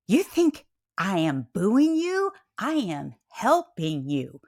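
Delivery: slow, emphatic protest; indignant wife defending herself; retold indignation. Indignant wife quarrelling in car.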